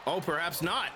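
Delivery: ordinary talk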